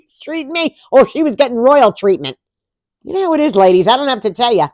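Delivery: sassy call-out; playful emphatic. Real, not acted. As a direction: sass it at the ladies. Ladies, playful punch.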